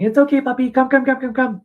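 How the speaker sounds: high, loud, gushing call to a puppy; what not to do: an overexcited high baby-talk voice calling the puppy; excited puppy talk. Shown as a demonstration. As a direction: coo and call the puppy over, way too excited. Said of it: "Not excited".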